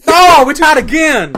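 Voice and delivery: loud, high, drawn-out cry of disbelief; excited exclamation. Real, not acted. Player seeing another tied dice roll, excited shout. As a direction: burst out high and stretch it.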